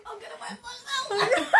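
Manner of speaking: slow words through helpless laughter; barely able to speak for laughing. Real, not acted. Laughing after hot sauce.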